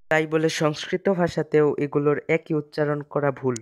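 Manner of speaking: ordinary talk, nothing marked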